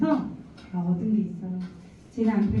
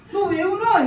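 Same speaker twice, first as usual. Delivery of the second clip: surprised; 10 semitones higher, 6 dB louder than usual. Retold or imitated. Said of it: "이랬더니".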